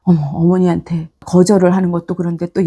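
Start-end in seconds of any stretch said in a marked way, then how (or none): none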